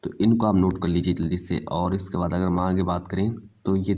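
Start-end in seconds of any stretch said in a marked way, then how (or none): none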